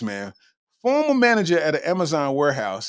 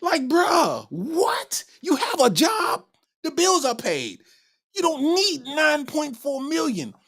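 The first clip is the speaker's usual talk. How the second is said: much higher pitched, near shouting, incredulous; disbelieving yell; 9 semitones higher, slower than usual. Real, not acted. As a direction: scold her like you can't believe it. Scolding the thief in disbelief.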